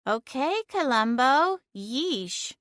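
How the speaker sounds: a lightly voiced cat, mocking; sarcastic cat. A narrator's reading. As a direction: tease her like a smug cat.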